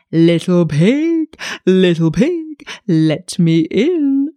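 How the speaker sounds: very slow, drawn-out wolf voice; wolf crooning at the door. A narrator's reading. Wolf coaxing the third pig, very slow.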